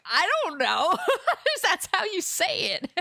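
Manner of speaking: loud, high-pitched defensive retort through laughter; mock-defensive outburst, high and laughing; exasperated laughing protest. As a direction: protest your innocence loudly, cracking up. Acted defensive retort to people's question.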